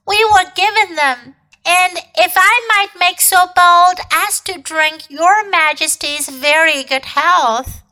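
high, humble fox voice; politely nervous old fox; timid fox. A narrator's reading. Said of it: "said the fox".

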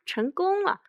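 high-pitched gleeful exclamation; sneaky little victory cry; gloating triumph. A narrator's reading. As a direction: crow over a trick that worked. Weasel secretly gloats over trick.

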